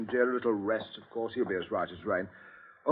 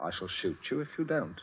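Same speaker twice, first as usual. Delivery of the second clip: quiet threat; 10 dB quieter, faster than usual. Acted. Captain to Smollett at gunpoint, quiet fast menace. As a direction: menace him softly, gun in hand.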